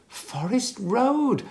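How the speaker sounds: slow, out of breath from chasing after her; a mild pant, words drawn out slow; lightly voiced breathless grandpa. A narrator's reading. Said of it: "panted Grandpa".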